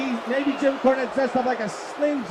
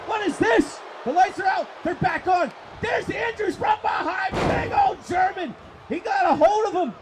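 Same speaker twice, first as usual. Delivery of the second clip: excited play-by-play; 8 semitones higher than usual. Real, not acted. Wrestling commentator calling a sudden reversal, excited. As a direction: call the action at a high excited pitch.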